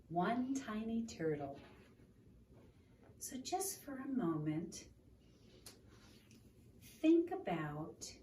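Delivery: gentle read-aloud storytelling; story narration. Acted. Reading picture book aloud.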